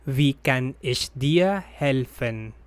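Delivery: ordinary talk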